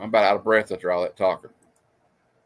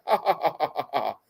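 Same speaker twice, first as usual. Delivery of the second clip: mock laugh; 3 semitones higher, 4 dB quieter than usual. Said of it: "Spock's like".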